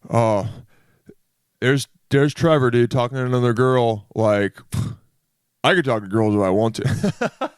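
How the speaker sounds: hint of a guy voice brushing off a rival; lightly voiced dudebro. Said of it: "with a guy voice".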